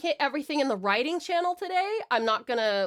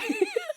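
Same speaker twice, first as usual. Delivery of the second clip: sudden squeaky laugh; high burst of laughter. Real, not acted. Laughing after swearing she'll try.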